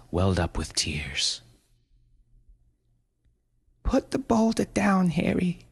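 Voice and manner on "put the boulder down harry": high, tearful mother voice; pleading mother. A narrator's reading. Mother pleading with possessed son, high and tearful. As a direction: beg him gently, near tears.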